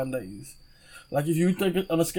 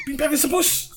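much higher retold yell; mock parting yell; 10 semitones higher than usual. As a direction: throw the line back like a fleeing kid. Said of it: "but he just".

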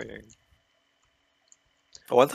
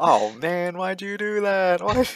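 high, drawn-out mock protest; whiny complaint; 9 semitones higher, 4 dB louder, slower than usual. Teammates protesting the stolen penta, whiny complaint. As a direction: wail it like a cheated teammate.

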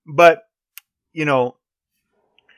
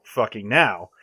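ordinary talk, nothing marked

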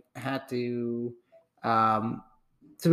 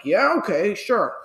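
mock agreeing yeah okay sure, pitched up; raised, dismissive yes; sarcastic okay; 7 semitones higher, 6 dB louder, slower than usual.

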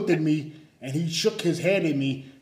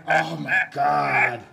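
dismayed realization; 4 dB louder, much slower than usual. Retold or imitated. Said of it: "And I was like".

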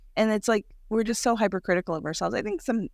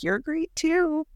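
slow, lifted, tender affirmation; self encouragement; 9 semitones higher, much slower than usual. Retold or imitated. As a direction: say it slowly like a gift to yourself. Encouraging her own reflection.

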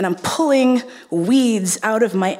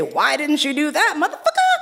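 mock indignant demand; 5 semitones higher, faster than usual. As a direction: pitch soaring way up on motherfucker, laughing through it. Mock outburst at her partner.

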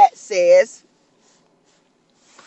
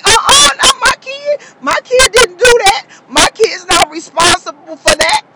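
tearful refusal; 10 semitones higher, 4 dB louder than usual. Acted. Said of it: "You gonna say".

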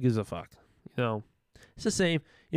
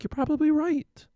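mock agreement; 13 semitones higher, 3 dB louder than usual. Agreeing with leftists.

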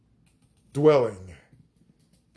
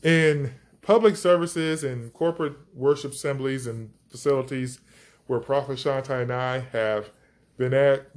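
usual speaking voice

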